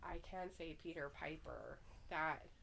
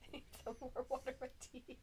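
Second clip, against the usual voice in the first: higher-pitched English tea talk; mock English accent; 5 semitones higher, 4 dB quieter than usual. Co-host echoing the advice about warm water with tea, mock English. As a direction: put on a playful English accent. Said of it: "do some English crap".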